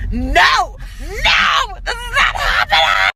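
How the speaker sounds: high yelled no, no; panicked yelling refusal; retold scream. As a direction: shout it in a panic. Retelling screaming at creepy driver.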